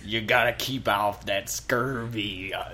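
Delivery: slow, straining hammed-up pirate; strained pirate voice. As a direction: push a pirate voice that's giving out. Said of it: "giving out as I try to do that voice".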